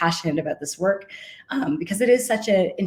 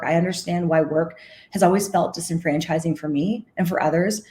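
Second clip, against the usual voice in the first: normal talking voice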